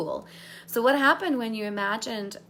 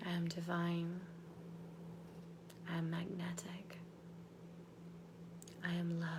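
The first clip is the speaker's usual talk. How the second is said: soft, lower whisper; whispered affirmations; 4 semitones lower, 13 dB quieter than usual. Acted. Coach repeating affirmations to lock them in, whispered. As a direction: hush each line into place.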